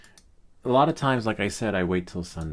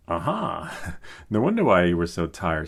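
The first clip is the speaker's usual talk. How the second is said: louder, laughing "aha" at a found phone; triumphant laughing catch; 5 dB louder than usual. Retold or imitated. Dad finds the missing phone under his daughter's pillow, laughing in triumph. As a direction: gloat with a laugh as you catch her out. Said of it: "I'm like".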